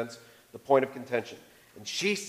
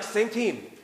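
bright, pitched-up upbeat call; eager reminder; 6 semitones higher than usual. Retold or imitated. Husband cheerfully reminding wife mid-argument, upbeat. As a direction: chirp it like a cheerful nudge. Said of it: "So I'll be like".

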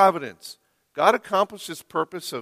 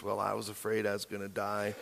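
lame excuse; 7 semitones lower, 14 dB quieter, faster than usual. Retold or imitated. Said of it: "Isaac offers his lame excuse".

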